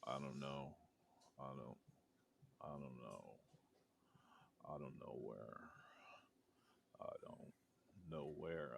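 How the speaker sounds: very soft, low whispery male voice; low echoing voice. Acted. Game master voices a lost man echoing from the woods, low and whispery. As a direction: murmur it low and halting, with long pauses. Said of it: "Just this low sort of male voice just echoing".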